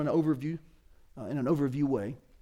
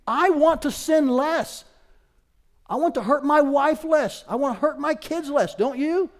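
higher, louder heartfelt admission; impassioned preaching; 8 semitones higher, 7 dB louder than usual. Real, not acted. Preacher admits to church he wants to sin less, impassioned. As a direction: press the confession on the listeners, voice raised.

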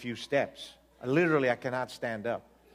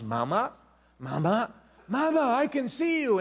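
put-on rising to a much higher child's call; little girl crying out; 9 semitones higher, 3 dB louder than usual. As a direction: call out to mama as the girl, climbing high.

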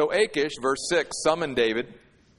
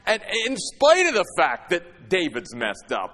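higher and louder, driving each phrase home; full-force preaching; 9 semitones higher, 4 dB louder than usual. Acted. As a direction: preach it out with growing fire.

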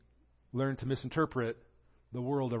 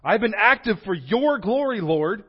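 much higher and louder, near shouting; accusing rant; 9 semitones higher, 12 dB louder than usual. Retold or imitated. Elijah rails at God about Israel, near shouting. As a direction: wail it all at God, aggrieved. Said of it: "practically accusing God here".

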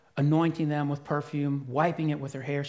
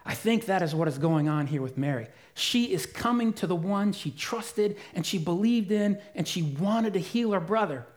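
ordinary delivery

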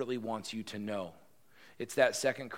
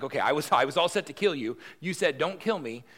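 high, loud, exasperated rant; king fed up with being passed around; furious king; 7 semitones higher, 12 dB louder than usual. Acted. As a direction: boil over at the runaround. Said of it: "his blood is starting to boil".

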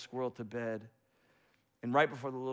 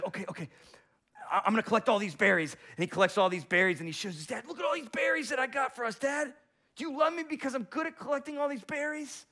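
put-on high, fast eager child voice; excited kid calling out; little squirrel child; 11 semitones higher, 5 dB louder, faster than usual. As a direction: show off berries to dad, bursting. Child squirrel showing berries.

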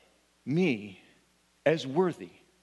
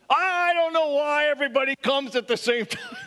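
high, laughing complaint from a grumpy cashier; 11 semitones higher than usual. Retold or imitated. Cashier griping to a customer about the rush, high and laughing. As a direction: toss off the gripe high and amused. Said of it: "And she says".

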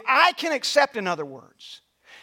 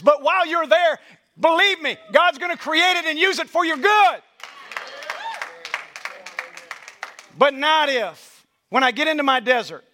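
preaching at full force; 3 semitones higher than usual. Acted. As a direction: build the sermon to a climax.

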